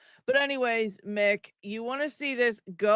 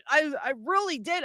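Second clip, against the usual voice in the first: normal talking voice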